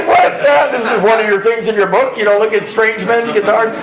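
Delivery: high, disbelieving echo; incredulous. Retold or imitated. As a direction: repeat her words in shock. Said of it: "You get, you know, like".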